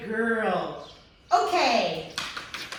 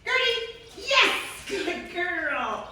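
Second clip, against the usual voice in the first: excited dog praise; 7 semitones higher, much slower than usual. Real, not acted. Trainer calling puppy Gertie, high excited praise. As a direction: call the puppy, then cheer her.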